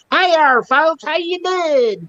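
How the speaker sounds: high, slow, hammed-up country greeting; high, slow YouTube hillbilly hello; thick country accent. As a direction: put on the country voice for the camera. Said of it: "we talk that country".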